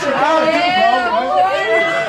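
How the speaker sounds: loud, high mocking taunt; mocking shout. Taunting opponent with a bra.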